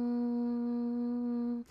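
long, soft monotone hum; buzzing hum. Real, not acted. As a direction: hold a quiet steady hum like a bee. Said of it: "That sounds like a bee buzzing".

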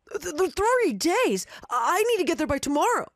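stammering high panicked cry; panicked yelp. Acted. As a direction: yelp it out in shock. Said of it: "The man practically yelped".

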